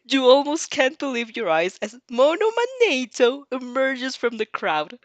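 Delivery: usual speaking voice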